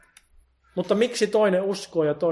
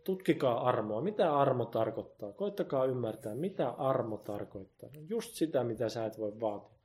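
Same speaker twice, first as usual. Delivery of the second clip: hushed appeal; 3 semitones lower, 8 dB quieter than usual. Acted. Pastor's hushed appeal on grace.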